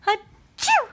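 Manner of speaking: loud, high pretend sneeze; loud fake sneeze. Dad fakes a loud sneeze for baby, loud and high. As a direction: blast a big fake achoo. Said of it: "he didn't do it".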